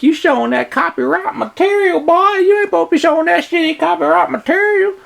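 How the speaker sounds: squeaky high mocking voice; high voice, scolding like a cartoon lawman. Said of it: "It'll be like".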